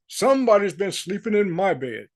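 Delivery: lightly voiced dismayed father bear; a touch of papa-bear dismay, put on. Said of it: "And Papa Bear, to his dismay, says".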